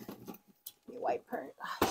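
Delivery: soft whisper while wiping. Real, not acted. Whispering to the camera while wiping her dusty cash box. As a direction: hush it as you dust her off.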